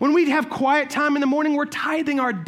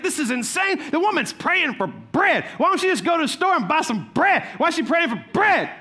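higher, exasperated neighbor griping; annoyed atheist neighbor; 6 semitones higher than usual. Retold or imitated. Atheist neighbor griping about her prayers, mildly exasperated. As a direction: scoff at her praying for bread. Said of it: "He's like".

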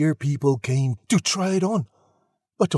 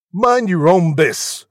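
loud, raised rebuff; curt prince reply; 5 semitones higher, 7 dB louder than usual. A narrator's reading. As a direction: rebuff them loudly. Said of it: "the prince replied".

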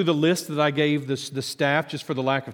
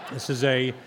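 laughing. Real, not acted. Laughing after the punchline.

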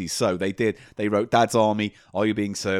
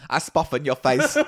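mock Italian accent. Mock Italian character bit.

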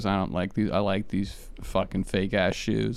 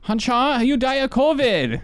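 very high, put-on Chinese accent; mock Chinese accent; 13 semitones higher, 4 dB louder than usual. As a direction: exaggerate a badly faked Chinese accent high in the voice. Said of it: "how do you do Chinese?".

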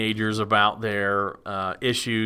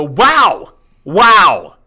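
loud, high, amazed \"Wow!\"; excited wow; 7 semitones higher, 14 dB louder than usual. Pretend. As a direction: shout it like a client who is blown away. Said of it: "make your clients go".

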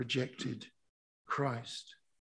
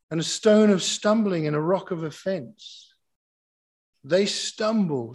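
loud, raised-pitch proclamation; forceful scripture preaching; 5 semitones higher, 10 dB louder than usual. Real, not acted. Preacher reading Peter to the congregation, loud and emphatic. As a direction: proclaim the verse with full pulpit force.

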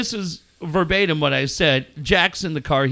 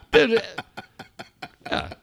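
ordinary talk

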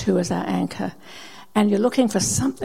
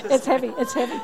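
laughing while straining; 6 semitones higher than usual. Real, not acted. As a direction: laugh through the effort. Laughing while holding heavy rock.

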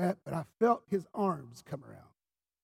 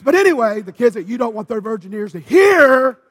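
loud preaching aside; 4 semitones higher, 16 dB louder than usual. Acted.

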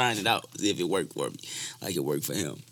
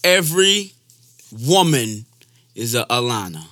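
loud, spaced-out proclamation; emphatic song intro; 8 dB louder than usual. Acted. Introducing his heartbreak song, loud emphatic proclamation. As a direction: proclaim it loudly, pausing between phrases.

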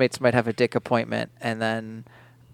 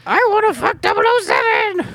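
much higher Kermit voice; 3 dB louder than usual. Retold or imitated. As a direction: squeeze it up high like Kermit.

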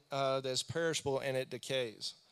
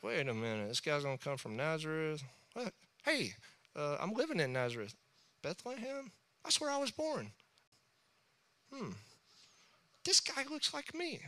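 imitating Jesus musing; 6 dB quieter than usual. Jesus reading scripture about himself, softly amazed. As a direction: muse quietly, then squeak in surprise. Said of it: "He's like".

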